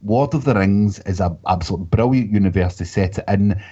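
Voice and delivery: ordinary talk, nothing marked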